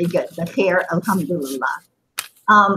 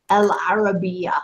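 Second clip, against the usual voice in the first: ordinary talk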